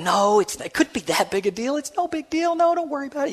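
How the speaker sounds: high, soft, soothing reassurance; consoling friends. Retold or imitated. Friends in the car consoling him, high and soft. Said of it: "they're trying to console me".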